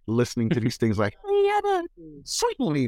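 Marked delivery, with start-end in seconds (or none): 1.3-2.9 high squeaky Curly yelp; Curly impression. Kid parroting the Three Stooges, high Curly squeak. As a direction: pitch way up like Curly. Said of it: "from Curly from the Three Stooges".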